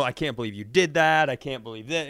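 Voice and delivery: usual speaking voice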